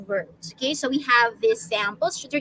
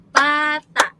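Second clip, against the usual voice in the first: "bata" in two loud, separate beats; syllable by syllable; 7 dB louder than usual. Shown as a demonstration. Teacher gives a Filipino example, two-beat syllable split. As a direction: punch out "bata" as ba, then ta. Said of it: "dalawang pantig".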